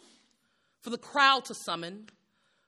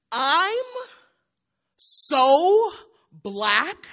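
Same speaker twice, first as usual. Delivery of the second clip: higher, louder punchline voice like a roast comic; hammed-up stand-up comic; 7 semitones higher, 6 dB louder than usual. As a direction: sell every joke to the crowd, big and brash. Mock stand-up joke routine.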